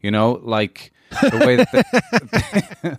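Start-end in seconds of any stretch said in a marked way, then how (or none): 1.2-2.9 high, slow, put-on voice of a snooty cat; high stretched-out cat voice with a laugh; posh cat impression. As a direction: lift the voice and drag it out like a haughty cat. Mimicking posh cat manner.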